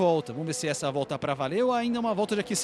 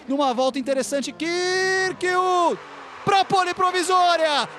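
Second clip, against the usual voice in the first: high, loud building shout; excited shout; 10 semitones higher, 4 dB louder, slower than usual. Acted. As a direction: yell it as he takes pole. Calling provisional pole.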